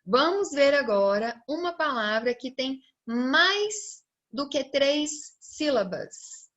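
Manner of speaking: usual speaking voice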